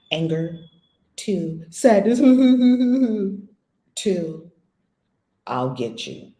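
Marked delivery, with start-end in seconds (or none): 5.5-6.3 mock revenge threat. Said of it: "Some people go to revenge".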